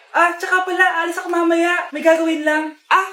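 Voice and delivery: ordinary talk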